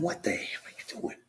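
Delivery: unvoiced hush to himself; whispered self-rebuke. Catching himself opening the wine, whispering in shock. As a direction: whisper it in disbelief at yourself.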